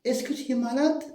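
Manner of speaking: "est-ce que tu es malade?" high with the tune rising on "malade"; teacher models the question to a friend; question intonation. Shown as a demonstration. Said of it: "Vous lui dites".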